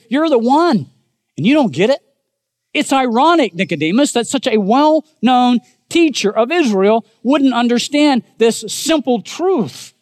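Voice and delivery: high, pressing preacher's rebuke; mock amazement that the expert missed it; Jesus rebuking. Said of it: "Jesus is rebuking Nicodemus".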